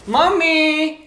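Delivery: calling out. Acted. Calls mother from another room.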